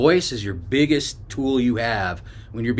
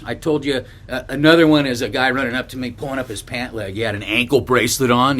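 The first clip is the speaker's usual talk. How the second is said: ordinary delivery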